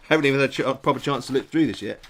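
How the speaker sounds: normal talking voice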